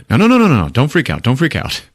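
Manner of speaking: hammed-up calming of a friend; mock panicked reassurance. Thor calming panicking Banner.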